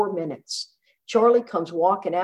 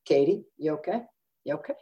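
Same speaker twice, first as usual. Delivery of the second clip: gentle concern; 4 semitones lower, faster than usual. Retold or imitated. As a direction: imitate a doctor carefully asking after a patient. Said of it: "the doctor goes like this".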